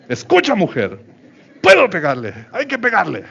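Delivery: hammed-up husband voice, rising high at the end; mock triumphant husband. Preacher mocking a husband telling his wife he may hit, hammed-up. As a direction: play the smug husband gloating to his wife.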